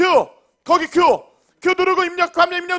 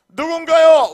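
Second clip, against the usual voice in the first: loud, rising hype call; booming prize announcer; 5 semitones higher, 6 dB louder, slower than usual. Real, not acted. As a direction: shout it out to the crowd. Prize winner reveal, hyping crowd.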